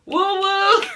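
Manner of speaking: whooped cheer rising high, breaking into a laugh; gleeful cheering. Retold or imitated. Viewer cheering on Phaedra's telling-off, gleeful whooping. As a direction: egg her on with high-pitched whoops.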